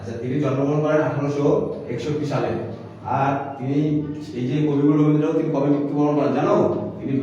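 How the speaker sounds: put-on classroom teacher addressing children. Candidate gives pretend pupils the poet's dates, projected teacher voice. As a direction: project the lesson to young pupils.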